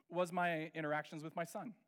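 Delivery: usual speaking voice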